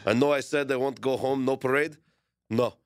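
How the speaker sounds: put-on lightly done Serbian accent, a bit halting; bad Serbian accent. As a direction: imitate Jokic with a clumsy Serbian accent. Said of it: "That was a terrible accent".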